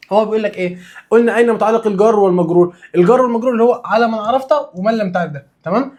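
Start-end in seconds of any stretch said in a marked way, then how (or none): none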